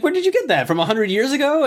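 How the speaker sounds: high, amused disbelief; incredulous mockery. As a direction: scoff at the old suit. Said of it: "he's like".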